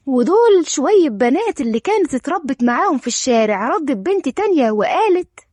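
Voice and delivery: usual speaking voice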